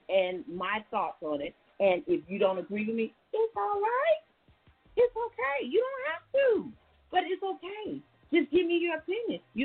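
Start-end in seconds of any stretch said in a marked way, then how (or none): 3.3-9.4 sing-song, soothing, very high; high soothing reassurance. Real, not acted. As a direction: coo it's okay to viewers. Soothing disagreeing viewers.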